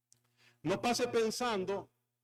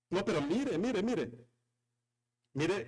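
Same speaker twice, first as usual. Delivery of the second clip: ordinary talk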